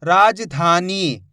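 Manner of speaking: राजधानी said slowly, syllable by syllable; slow dictation. Shown as a demonstration. Teacher dictating a word to students, slowly. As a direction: dictate राजधानी so they can write it. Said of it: "शब्द को अच्छी तरीके से सुनो".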